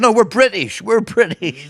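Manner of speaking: high, loud mock outrage; hammed-up indignant insistence; mock indignant protest. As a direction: protest it loudly, pitched right up. Imitated protest at being called Irish.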